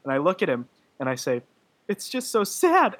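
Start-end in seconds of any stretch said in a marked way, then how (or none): 1.9-2.9 high, slow mock-weepy wail; retold tearful. Moviegoer retelling what she said to her friend in the credits, tearful wail. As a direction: act out tipping back into tears, loud and high. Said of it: "the tears came out again".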